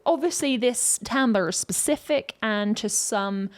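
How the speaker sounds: ordinary talk, nothing marked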